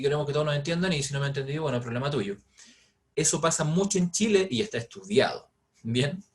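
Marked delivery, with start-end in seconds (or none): 0.0-2.4 rushing the words together; quick slurred Chilean chatter; fast mumbled talk. Shown as a demonstration. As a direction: rattle it off carelessly. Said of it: "si yo lo estoy hablando así".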